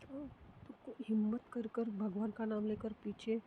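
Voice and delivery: ordinary delivery, nothing marked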